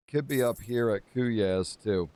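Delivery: ordinary talk, nothing marked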